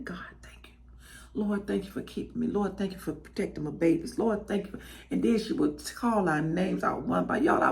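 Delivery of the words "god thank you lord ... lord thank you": hushed late-night prayer to God, soft and quiet; soft whispered thanks to the Lord. Retold or imitated. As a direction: pray under your breath like mama on the sofa. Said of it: "I would hear her in there praying".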